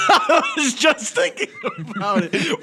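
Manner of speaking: high, slow, squealing through laughter; helpless laughter. Real, not acted. Friend baffled by the stroke question, cracking up. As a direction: barely get the words out for laughing.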